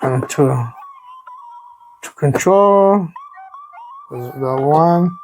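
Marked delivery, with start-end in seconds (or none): none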